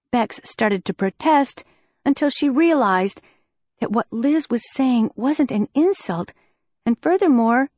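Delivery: ordinary delivery, nothing marked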